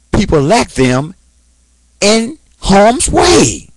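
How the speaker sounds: high, slow angry warning; angry rant. Real, not acted. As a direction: drag it out in real anger. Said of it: "and I am angry".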